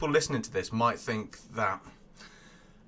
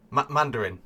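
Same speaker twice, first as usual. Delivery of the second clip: ordinary delivery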